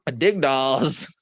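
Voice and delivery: ordinary delivery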